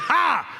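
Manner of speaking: high, scoffing laugh burst; hammed-up taunting laugh; jeering "ha". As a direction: crow it in mockery. Onlookers jeer at the circumcised.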